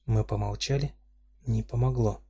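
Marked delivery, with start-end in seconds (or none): none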